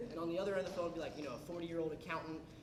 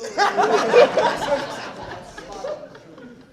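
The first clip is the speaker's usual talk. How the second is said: loud high-pitched laugh; high burst of laughter; 13 semitones higher, 18 dB louder than usual. Real, not acted. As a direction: break into laughter. Laughs at own story.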